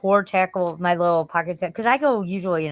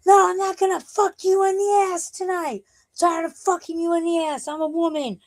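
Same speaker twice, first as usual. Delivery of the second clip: high-pitched mock drunk scream; 11 semitones higher than usual. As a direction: imitate her screaming, high but not full volume. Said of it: "she just started screaming at him".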